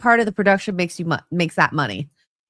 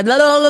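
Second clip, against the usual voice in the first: loud, raised babble of blahs; mock gibberish; 4 semitones higher, 6 dB louder, faster than usual. Mimicking a clip whose last word was unclear, mock gibberish. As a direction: babble it out like words you couldn't make out.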